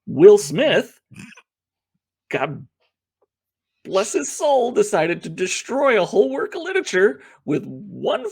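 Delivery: loud, high exasperated rant. Real, not acted. Rant about ruined book.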